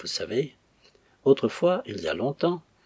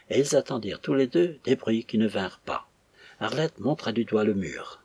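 usual speaking voice

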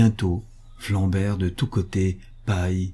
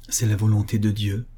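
usual speaking voice

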